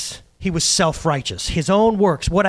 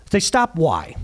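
usual speaking voice

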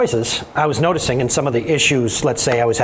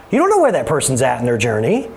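usual speaking voice

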